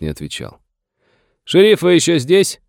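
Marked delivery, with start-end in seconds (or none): 1.4-2.6 high, loud long-distance question; raised, carrying hail; calling out. A narrator's reading. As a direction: call to someone out of sight. Morgan calls across to hidden sheriff.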